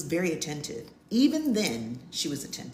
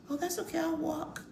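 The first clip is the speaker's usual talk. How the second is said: nervous polite refusal; 10 semitones higher, 5 dB quieter than usual. Retold or imitated. Retold polite refusal of ride.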